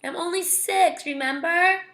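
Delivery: put-on high, whiny little-kid reminder; whining. A narrator's reading. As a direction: plead like a whining six-year-old. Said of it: "Daniel whined".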